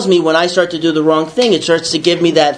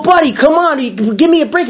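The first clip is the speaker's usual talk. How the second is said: higher, wheedling plea; pleading; 9 semitones higher than usual. Retold or imitated. Asking the tow guy for mercy, higher pleading. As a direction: beg the guy for a break. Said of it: "I go".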